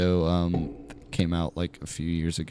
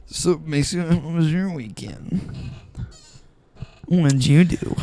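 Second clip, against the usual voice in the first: high put-on voice, slower; mock host voice; 10 semitones higher, 4 dB louder than usual. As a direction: ask like a cheesy TV host. Mock host voice asking about weekend.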